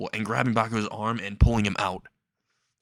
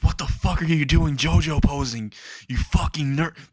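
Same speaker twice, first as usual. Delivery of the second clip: higher-pitched, indignant retold rant; mock outrage; 4 semitones higher than usual. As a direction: burst out cursing in mock fury. Bakugo bursts out cursing.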